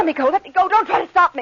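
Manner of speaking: loud, high pleading to be let go; frantic protest. Acted. Woman held back in the street, frantically protesting. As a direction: pull away and cry out. Struggling against held arm.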